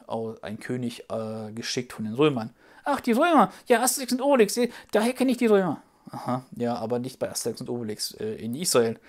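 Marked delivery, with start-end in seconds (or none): 2.8-5.8 high, eager little puppet voice; proudly showing off what it knows; excited puppet child. Acted. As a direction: puppet child pleased to know the Romans.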